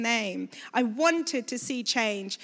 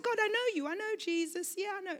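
higher, breezy voice of a careless believer; imitating her younger self; 8 semitones higher, 6 dB quieter, faster than usual. Woman retelling her casual answer to God, lightly higher. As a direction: toss it off, sure she already knows God. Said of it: "God, I said".

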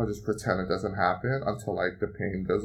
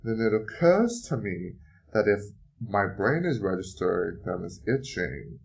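ordinary talk, nothing marked

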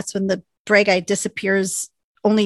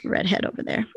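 whispered aside; 8 dB quieter, faster than usual. Retold or imitated. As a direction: drop to a whisper, sharing a secret. Aside about the redhead.

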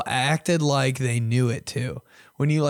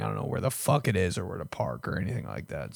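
fast, breathless whispered grumble; frustrated whisper; 6 semitones lower, 9 dB quieter, faster than usual. Real, not acted. Host grumbling to listeners about insurance, whispered. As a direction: mutter it under your breath, annoyed.

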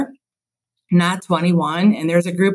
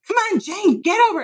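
urging friend; 12 semitones higher than usual. Retold or imitated. Son urging girl over fence.

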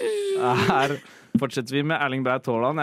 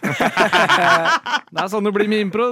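laughing; 8 semitones higher, 8 dB louder, much slower than usual. Real, not acted.

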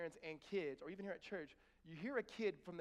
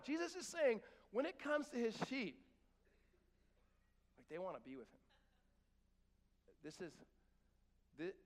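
ordinary delivery

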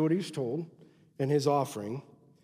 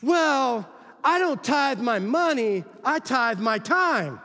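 mock churchgoer excuse; 6 semitones higher, 3 dB louder, slower than usual. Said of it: "here's what I hear people say".

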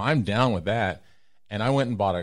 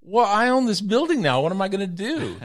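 much higher, louder, laughing plea; exasperated plea; 12 semitones higher, 4 dB louder than usual. Retold or imitated. New owner stuck with a useless building, high exasperated laughing plea. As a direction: wail it in mock despair. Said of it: "I'm like".

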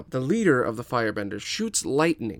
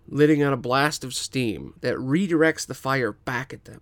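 usual speaking voice

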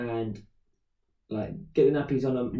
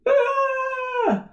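high shocked scream; the sister's shriek at the headbutt; 6 dB louder than usual. Retold or imitated. Sister startled by headbutt, high shriek. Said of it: "She was like".

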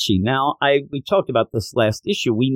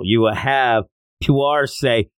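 ordinary talk, nothing marked

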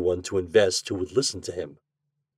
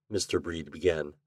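ordinary delivery, nothing marked

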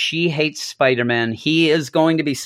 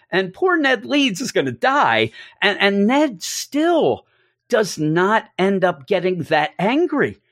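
normal talking voice

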